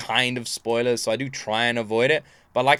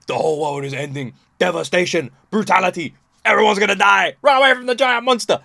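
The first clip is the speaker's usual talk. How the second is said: mock-apocalyptic proclamation, climbing higher and louder; doomsday panic; 5 semitones higher, 4 dB louder than usual. Reactor voicing the boss theme's menace, mock doom. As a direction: build from grave announcement to loud, hammed-up alarm. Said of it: "the boss theme, the O S T, is like".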